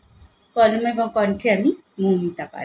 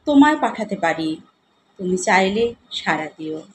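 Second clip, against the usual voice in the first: poem recitation; 3 semitones higher, 5 dB louder than usual. Acted. Poet offers gifts to a loved one, recited as verse. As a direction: declaim each couplet, then let it settle.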